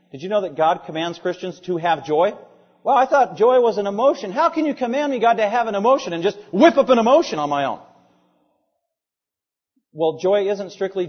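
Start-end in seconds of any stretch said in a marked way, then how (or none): 2.8-7.8 higher-pitched mock protest; protesting objector. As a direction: object like a baffled, put-upon believer. Acts out a listener's objection.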